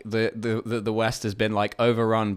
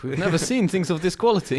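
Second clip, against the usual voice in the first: higher, put-on Russian visitor voice; awed Soviet delegate; 7 semitones higher, 3 dB louder than usual. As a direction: play a mock Soviet delegate marvelling at the goods. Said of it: "a delegation from the Soviet Union".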